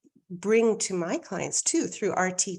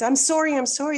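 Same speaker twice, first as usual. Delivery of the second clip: quick texted apology; 7 semitones higher, 5 dB louder, faster than usual. Retold or imitated. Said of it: "her first thing's".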